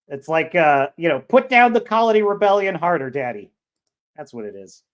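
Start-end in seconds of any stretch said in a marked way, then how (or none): 1.2-3.5 mock plea.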